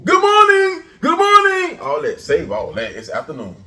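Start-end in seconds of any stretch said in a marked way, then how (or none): 0.0-1.8 very high, booming morning call; big high-pitched bellow; shouted greeting. Acted. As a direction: holler it out high and loud.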